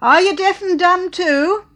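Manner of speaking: high, loud shout; shout over the train. Acted. Old lady to Clara over train noise, high shout. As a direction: shout above the roar of the train. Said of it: "rose in a shout above the roar".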